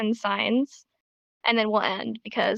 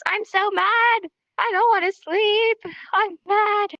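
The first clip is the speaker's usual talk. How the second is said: higher, hammed-up tantrum; mad at oneself; 7 semitones higher, slower than usual. Teacher demonstrating mad with two hands, mock-angry. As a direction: pretend to fume like a cranky child. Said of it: "then you get mad at yourself".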